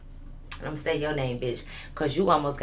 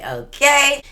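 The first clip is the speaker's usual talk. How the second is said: emphatic okay; 8 semitones higher, 7 dB louder than usual. Real, not acted. Punchline tag after drink intro.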